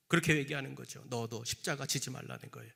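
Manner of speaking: ordinary delivery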